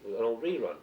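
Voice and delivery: ordinary talk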